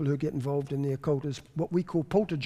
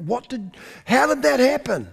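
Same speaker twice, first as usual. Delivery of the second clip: higher and louder, astonished principal; amazed; 8 semitones higher, 4 dB louder than usual. Retold or imitated. Principal phones the preacher, amazed and raised. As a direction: ask it in disbelief. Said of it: "And he said".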